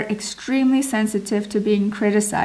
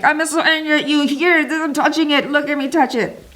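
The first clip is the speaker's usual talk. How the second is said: higher, quick, taunting imitation; mock angry husband; 5 semitones higher than usual. Husband provoking wife by touching her thing, mock-angry taunt. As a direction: goad her in pretend anger. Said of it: "in his mind, he's like".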